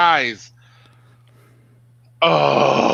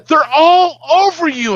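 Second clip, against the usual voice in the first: high, loud, slow alarm; wife telling husband bugs cover him; retold alarmed wife; 13 semitones higher, 7 dB louder than usual. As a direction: stretch it out in horror. Wife panicking about bugs.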